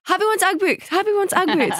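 high-pitched mock toddler wail; imitated toddler tantrum. Mother imitating her toddler's tantrum over Ugg boots, high-pitched protest. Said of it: "fully spat the dummy and was, like, screaming".

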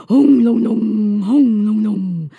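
loud, slowing rumble of thunder. Retold or imitated. Storyteller imitates the thunder over the pond, loud and slow. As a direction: boom it out like rolling thunder.

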